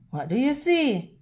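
ordinary delivery, nothing marked

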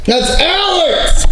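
slow, drawn-out shout; alarmed shout. Real, not acted. Startled by a noise and naming Alex, drawn-out shout. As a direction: shout it out, stretching the words.

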